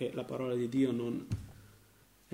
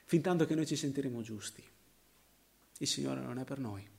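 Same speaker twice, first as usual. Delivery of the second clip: usual speaking voice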